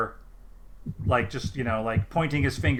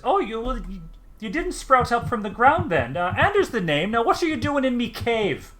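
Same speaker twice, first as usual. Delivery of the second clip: put-on high, loud, flustered farmer with a rustic accent; high startled halfling yelping; 10 semitones higher, 6 dB louder than usual. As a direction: jump back and blurt it out. Startled halfling jumps back.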